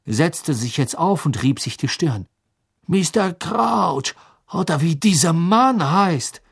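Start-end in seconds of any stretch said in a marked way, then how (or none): 2.9-6.4 dazed Krum. A narrator's reading. Krum dazed after attack.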